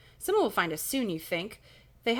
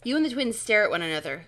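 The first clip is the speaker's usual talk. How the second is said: ordinary delivery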